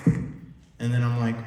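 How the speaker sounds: normal talking voice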